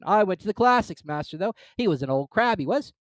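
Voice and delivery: high, loud gruff creature; gruff Gryphon. Acted. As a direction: bluster like a rough beast.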